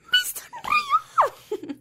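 squealing with laughter, soaring high; giddy delight. Retold or imitated. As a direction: melt with joy that a stranger smiled. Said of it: "pero al final digo". (chillido de alegría entre risas, muy agudo)

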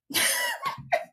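high-pitched laugh. Real, not acted. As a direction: burst out in a soft, high laugh. Laughs at her own translation.

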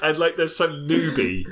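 ordinary talk, nothing marked

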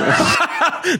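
high laugh. Real, not acted. Laughing at joke.

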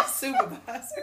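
word squeezed out through laughter; laughing. Real, not acted. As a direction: crack up mid-quote, voice rising. Breaks up while retelling quote.

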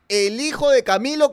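ordinary delivery

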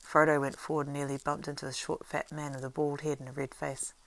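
ordinary delivery, nothing marked

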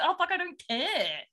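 high-pitched hammed-up complaint; mock exasperation. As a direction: play someone totally sick of the chatter. Imagined captors fed up with cat talk.

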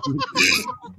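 very high squeal of laughter. Real, not acted. Laughing at his own joke, high squeal.

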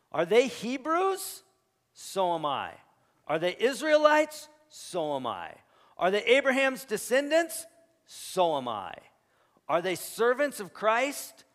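preaching at full force. Acted. Preacher reading Paul's boasts to the congregation, loud and high. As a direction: hammer each question, drop the answer.

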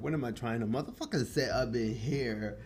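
ordinary delivery

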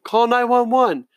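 high, slow urgent yell. A narrator's reading. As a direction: shout it across the crowd. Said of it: "Someone else yelling".

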